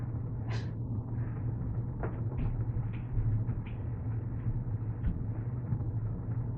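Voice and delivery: long breathless embarrassed laughter; can't stop laughing at herself; laughing fit. Real, not acted. Painter laughing at her own weirdness on camera, real laughing fit.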